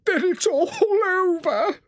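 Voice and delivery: very high, slow tearful wail; sobbing. A narrator's reading. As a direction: wail it out through sobs. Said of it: "sobbed the toad".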